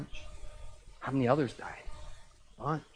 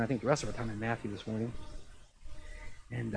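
normal talking voice

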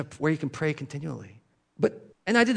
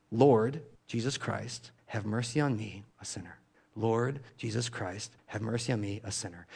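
softer and lower, fading to near silence; quiet repeated prayer; 3 semitones lower, 6 dB quieter than usual. Shown as a demonstration. Preacher showing monks repeating the Jesus prayer, hushed. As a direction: murmur it again and again, trailing away. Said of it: "They do it again".

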